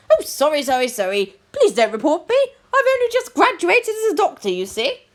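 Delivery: posh doctor in a high fluster; flustered pleading. Acted. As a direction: plead apologetically, pitch leaping up. New doctor begs not to be reported.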